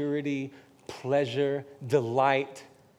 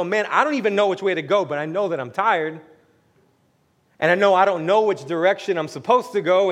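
loudly voiced weariness; 7 semitones higher, 17 dB louder than usual. Weary person voiced in sermon.

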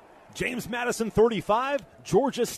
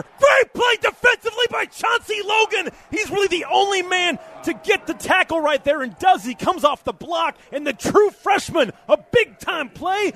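excited; 7 semitones higher, 4 dB louder than usual. Real, not acted. Big defensive play called.

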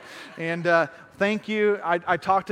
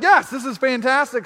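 higher and louder, gleeful; a delighted yes, this is fantastic; excited yes; 6 semitones higher, 5 dB louder than usual. Retold or imitated. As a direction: celebrate finding the keys. Finding the master keys.

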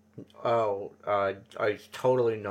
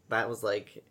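usual speaking voice

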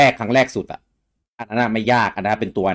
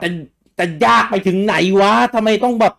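exasperated outburst; 11 semitones higher, 5 dB louder than usual. Retold or imitated. Frustrated gamer exclaiming.

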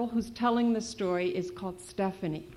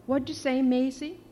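higher, louder playful question; playful question to dog; 5 semitones higher, 6 dB louder than usual. Acted. Girl asking her dog a question, playful and higher. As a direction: ask the dog as if she really talked.

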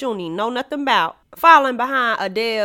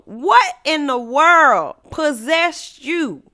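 normal talking voice

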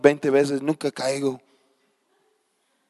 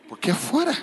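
puzzled; 13 semitones higher, faster than usual. Retold or imitated. Retold puzzled question.

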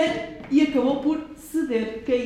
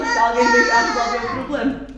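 mock crying; 8 semitones higher than usual. Mock crying while explaining.

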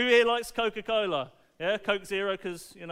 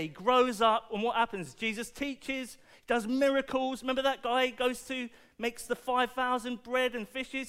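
ordinary delivery

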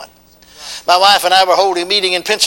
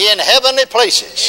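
preaching call; 6 semitones higher, slower than usual. Acted. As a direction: chant it out line by line. Call and response.

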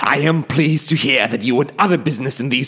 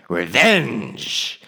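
scornful outburst; 3 semitones lower, 6 dB louder than usual. Acted. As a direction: spit out Revenge! in contempt.

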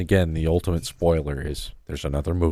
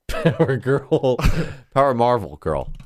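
laughing; 4 semitones higher than usual. Real, not acted. Laughing at the costume name.

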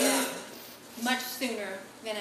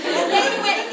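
ordinary talk, nothing marked

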